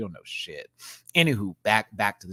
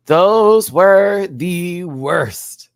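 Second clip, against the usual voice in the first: stretched, louder and higher; slow emphatic drawl; 8 semitones higher, 12 dB louder, much slower than usual. Real, not acted. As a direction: drag every word out in disgust. Drawn out emphatic repeat.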